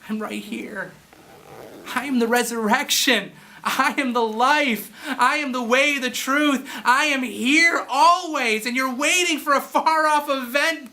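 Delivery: lightly voiced Jesus, high and slow, earnest; Jesus pleading. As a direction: speak as Jesus calling his people. Voicing Jesus to listeners.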